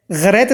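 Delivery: "ग्रैत" begun with a throaty ghain; wrong throat sound. Shown as a demonstration. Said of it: "गैन अलग होता है जो हलक से". ("ग्रैत" हलक वाले ग़ैन से)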